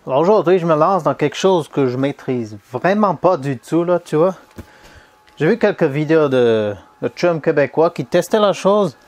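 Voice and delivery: amateur put-on Quebec French accent, lively and emphatic; attempted Québécois accent. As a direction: imitate a Québécois, not quite mastering it. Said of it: "l'accent québécois".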